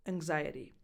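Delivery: Anxiety said ang-ZAI-uh-tee, the G melted into the N; softened X. Shown as a demonstration. Coach repeats Anxiety with a soft ngz. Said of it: "the N before the X softens that G sound".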